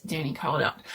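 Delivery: usual speaking voice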